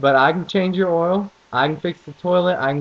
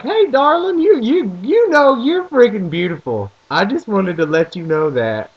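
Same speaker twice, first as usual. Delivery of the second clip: gushing Southern charm; 2 semitones higher, 4 dB louder than usual. Retold or imitated. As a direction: lay the Southern flattery on thick. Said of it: "I'll seriously be like".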